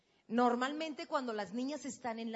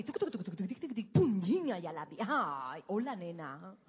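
mock radar beeps; 5 semitones lower than usual.

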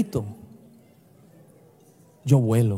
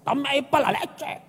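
gruff grandfather; 5 semitones higher than usual. Retold or imitated. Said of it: "mi abuelo no era tierno con mi abuela".

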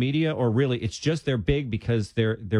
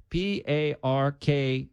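spelled out slowly; 2 semitones higher, much slower than usual. Shown as a demonstration. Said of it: "spelling out a title".

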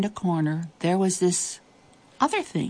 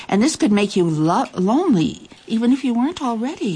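plain reading voice

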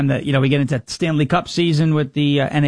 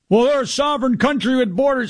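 mocking a Canadian critic; 7 semitones higher than usual. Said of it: "I had one of these guys going".

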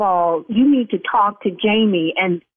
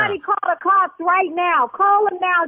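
alarmed call for cops; 7 semitones higher than usual. Acted. Owner panicking, calling the police.

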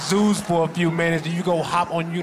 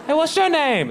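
woman; 11 semitones higher, 3 dB louder than usual. Retold or imitated. Woman voiced in joke.

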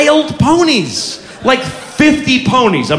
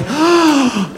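gasp; 5 semitones higher than usual. Retold or imitated. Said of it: "And she's like".

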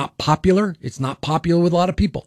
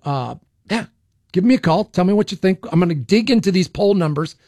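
ordinary delivery

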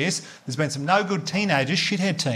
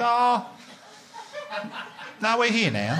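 higher, airy resignation with a laugh; mock breezy; 8 semitones higher, slower than usual. Embarrassed customer shrugs to the cashier, high and airy. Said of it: "I was like".

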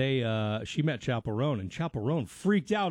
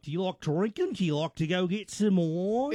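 mock New Zealand accent; 3 semitones higher than usual. Imitating dater asking about drinks, New Zealand accent. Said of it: "because of the accent".

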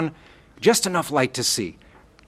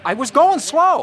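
distressed insistence; 14 semitones higher, 6 dB louder than usual. Acted. To sheriff, upset.

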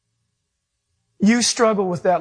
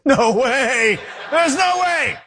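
high, laughing shout of disbelief; 4 semitones higher, slower than usual. Retold or imitated. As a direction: laugh it out, stunned. Reunion in heaven, disbelief.

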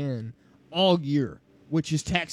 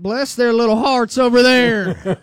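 high, loud Southern "bless their little hearts"; mock Southern belle; 7 semitones higher, 10 dB louder than usual. Co-host showing how the South would mock Ohio State, in a loud high Southern accent. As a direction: ham up a sugary Southern accent, much higher and louder. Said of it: "here's how it would sound for the South".

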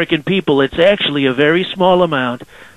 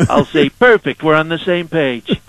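Donald Trump impression. Trump calling in to radio hosts, swaggering and boastful. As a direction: sell every line like the president on a call-in.

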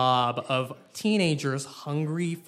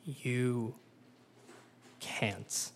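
sly knowing Sarah; 6 semitones lower, 10 dB quieter than usual. Acted. Sarah's sly low reply.

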